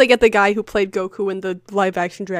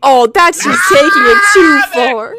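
mocking imitation; 3 semitones higher, 7 dB louder than usual. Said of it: "You're like".

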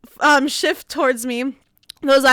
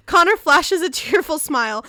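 ordinary delivery, nothing marked

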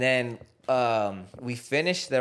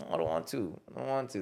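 soft, lower sulky mumble; sulky kid; 4 semitones lower, 8 dB quieter than usual. Retold or imitated. Coach imitating the kid refusing, soft and sulky. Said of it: "He's like".

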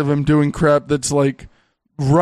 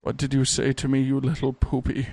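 soft, lowered mock threat; silly soft parody; 4 semitones lower, 7 dB quieter than usual. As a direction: say it like a gentle joke. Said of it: "I wish that that's what he said".

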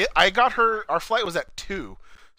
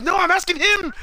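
high, firm correction; insistent driver; 5 dB louder than usual. Retold or imitated. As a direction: cut her off, pitched way up. Driver correcting who answers.

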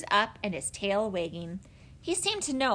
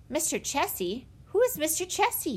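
puzzled man hearing an unknown name; surprised; 8 semitones higher than usual. Acted. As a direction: pitch jumps high on a baffled question. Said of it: "Mister Winters' turn to be surprised".

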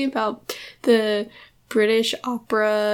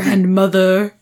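mock formal; 3 semitones lower, 10 dB louder than usual. Co-host teasing the formal name for grandma, mock formal. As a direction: announce it with exaggerated formality. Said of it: "I think that's so formal".

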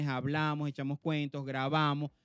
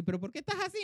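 mock John asking; 12 semitones higher, 3 dB quieter, faster than usual.

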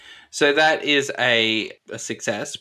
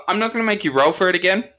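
ordinary talk, nothing marked

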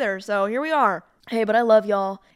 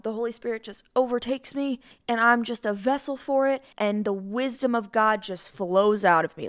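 normal talking voice

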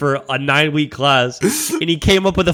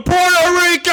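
much higher, louder mock yell of a country name; yelled nationality; 3 dB louder than usual. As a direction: belt it out like a kid in dodgeball. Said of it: "Joel Figueroa is going".

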